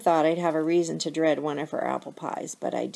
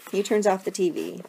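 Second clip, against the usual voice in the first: ordinary delivery, nothing marked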